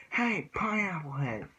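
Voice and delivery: slow, high teasing call; playful taunt; mocking nickname. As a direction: tease him about his spiky hair. Mocking a rival's hair.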